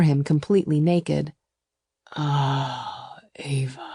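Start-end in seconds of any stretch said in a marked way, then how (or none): 2.2-3.9 soft, emotional male sigh of her name; throbbing with emotion. A narrator's reading. Lover overcome as he sees her, soft and moved. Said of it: "His voice throbbed with emotion".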